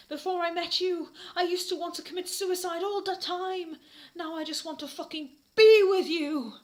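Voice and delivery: high, loud passionate declaration; hammed-up lovesick Draco. Draco declaring love before the class, high passionate. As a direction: declare it over the top.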